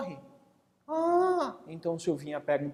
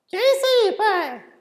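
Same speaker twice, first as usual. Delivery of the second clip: put-on high, loud kid's question; curious child; 13 semitones higher, 6 dB louder than usual. Boy asking his father a puzzled question. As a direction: ask it up high like a puzzled kid.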